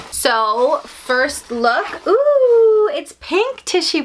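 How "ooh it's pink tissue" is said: high, loud excited ooh; a burst of real delight. Real, not acted. Opening box, sees tissue.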